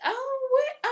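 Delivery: imitating mom gasping in surprise; mom's shocked squeal. Mom hearing the neighbour is pregnant, high shocked squeal.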